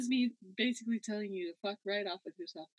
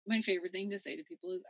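normal talking voice

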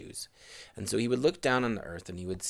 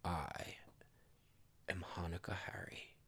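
whispered secret; 2 semitones lower, 8 dB quieter than usual. Acted. Harry revealing himself to the couple, whispered secret.

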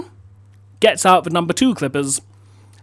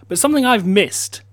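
ordinary delivery

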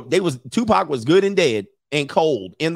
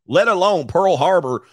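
ordinary talk

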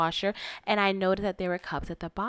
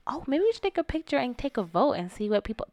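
light, higher-pitched, non-aggressive suggestion; casual suggestion; 6 semitones higher than usual. Retold or imitated. Woman replaying her remark to prove it wasn't aggressive, lightly suggesting. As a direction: float it as a harmless idea. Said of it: "that's not aggressive".

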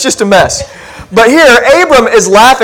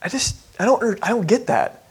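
softer, confused murmur to yourself; puzzled mutter; 12 dB quieter than usual. Retold or imitated. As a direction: quietly admit you don't get it. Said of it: "You're like".

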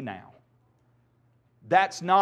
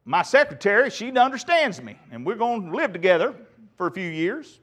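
loud, pitched-up aggrieved husband; man voice; 4 semitones higher, 4 dB louder than usual. Acted. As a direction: plead your case to the preacher, hammed up. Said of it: "there's my man voice".

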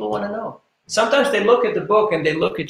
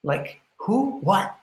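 put-on puzzled teenage son; 3 semitones higher, 7 dB quieter than usual. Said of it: "his son looks at him and goes like".

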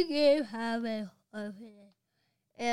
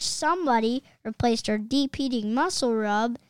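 loud complaint that drops off at the end; furious coach yelling at the field; coach's shout; 8 dB louder than usual. Acted. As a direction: yell it in outrage. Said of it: "shouted the coach".

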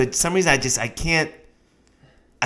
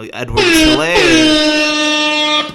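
slow high drawn-out name; high stretched name; 5 dB louder, much slower than usual. Pretend. Listing running backs, slow high drawn-out name.

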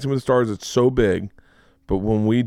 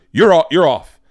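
loud retold shout, you're off; angry shout; 6 semitones higher, 8 dB louder than usual. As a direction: bark it like an angry host. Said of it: "he goes".

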